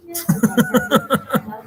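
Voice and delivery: a high, delighted laugh at a comment; burst of laughter. Real, not acted. Laughing at a viewer's joke, a high burst of laughter.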